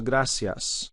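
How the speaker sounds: gracias said grasias with an s; Latin American accent. Shown as a demonstration. Teacher contrasts Latin American s with Spain th. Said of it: "as opposed to".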